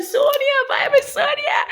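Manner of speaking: high, loud excited call across the road; imitating fans shouting her name. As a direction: hail her like a thrilled fan spotting a star.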